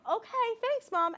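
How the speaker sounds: put-on high little-girl "okay thanks" to mom; young daughter voice. Kid thanking mom for frozen waffles, high and polite.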